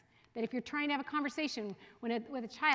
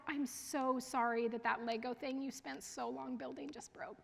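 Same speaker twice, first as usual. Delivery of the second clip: quiet, consoling parent voice; gentle empathetic murmur; soft sympathy; 8 dB quieter than usual. Shown as a demonstration. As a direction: soothe a child over a broken toy. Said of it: "position yourself alongside them".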